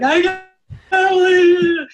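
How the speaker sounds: high, loud mock wail; imitating crying. Coach recalling herself in tears, a mock anguished wail. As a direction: replay the sob of disbelief. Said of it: "bawling my eyes out".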